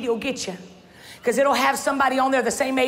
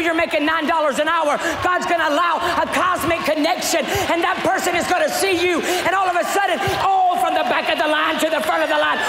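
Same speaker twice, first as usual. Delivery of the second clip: preaching climax; 3 semitones higher than usual. Acted. Preacher to congregation about her promotion, shouting climax. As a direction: ride the build to the shout.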